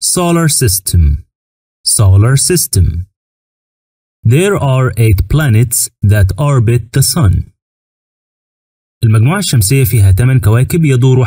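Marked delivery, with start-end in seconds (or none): 0.0-1.0 slow model pronunciation. Shown as a demonstration. Teacher modelling "Solar System" slowly for students. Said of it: "والمجموعة الشمسية يعني". 4.2-7.5 very slow, careful English for learners; slow teaching read. Shown as a demonstration. Teacher reading the English sentence slowly to the class. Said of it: "teacher models English sentence".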